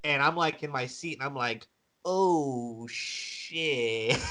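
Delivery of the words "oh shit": slow, drawn-out, hushed realization; retold uh-oh dread. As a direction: let it sink in slowly, half laughing. Retold dawning worry after upset win.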